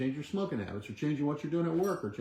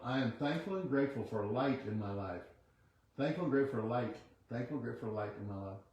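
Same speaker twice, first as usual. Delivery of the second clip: quiet affirmation; 6 dB quieter than usual. Acted. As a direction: murmur the affirmation like a meditation. Leads a chanted affirmation.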